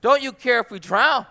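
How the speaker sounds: loud, high panicked plea over the storm; frantic cry for help. Acted. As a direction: shout it as terrified men in a sinking boat. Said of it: "the disciples wake him up".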